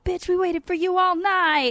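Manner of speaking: high, loud, excited yelling; retold scream. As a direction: yell it into the camera. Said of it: "screamed into my camera".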